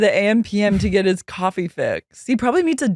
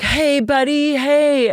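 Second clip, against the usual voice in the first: high, over-friendly dad greeting; gushing mock-fatherly hello; Jax greeting his son; 8 semitones higher, slower than usual. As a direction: imitate an over-eager loving father. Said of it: "he's like".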